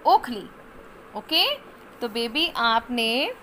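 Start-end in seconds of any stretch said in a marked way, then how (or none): 0.1-0.5 ओखली said quick and louder with a short ओ; teacher modelling a short ओ; short vowel demo. Shown as a demonstration. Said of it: "जल्दी finish हो रहा ना".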